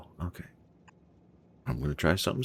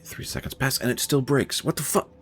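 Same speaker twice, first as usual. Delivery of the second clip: higher and fast, exasperated disbelief; baffled outburst; 6 semitones higher, 4 dB louder, faster than usual. Real, not acted. Streamer sees his train line break again, exasperated surprise. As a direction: blurt it out in frustrated surprise.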